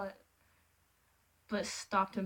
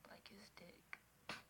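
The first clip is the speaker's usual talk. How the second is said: very soft, slow whisper; whispered aside; 27 dB quieter, much slower than usual. Real, not acted. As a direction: mutter a cheeky aside. Whispered aside while reading.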